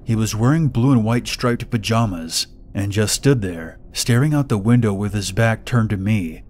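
ordinary delivery, nothing marked

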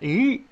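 overdone demo of the forward-jaw "yi"; exaggerated "yi". Shown as a demonstration. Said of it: "a little bit, yi".

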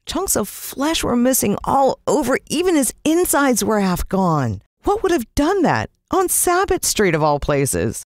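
put-on high, shaken boy's voice; a kid rattled by what he saw; upset boy. Jalon tells his friend how the dog was eaten, high and shaken.

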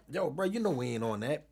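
mild, easygoing refusal; calm objection. Retold or imitated. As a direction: say it lightly, no heat. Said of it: "I said it calm".

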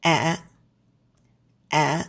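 A short and low, cut off; short, low, heavy A. Shown as a demonstration. Teacher demos the short low dot tone. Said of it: "short and low".

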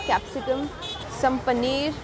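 ordinary delivery

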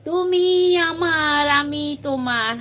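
slow, lingering romantic phrase; drawn out slowly. Real, not acted. Calling a missing viewer, drawn out slowly. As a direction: stretch each word out tenderly.

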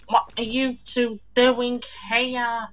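high, slow, mock teacher voice; high slow voice of a teacher catching two kids; imitating teacher. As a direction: put on a prim schoolteacher scolding. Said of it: "She's like".

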